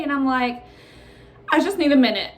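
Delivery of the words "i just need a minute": higher, louder imitation of crying; retold near-tears plea for a moment; mock tearful. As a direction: act choked up, half laughing. Tearful, trying to breathe, retold.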